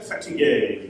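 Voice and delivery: "fatigué" in a poor mock French accent. Shown as a demonstration. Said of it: "saying it in a French accent".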